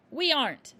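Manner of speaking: loud blunt reply; curt father. A narrator's reading. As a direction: crush her hope.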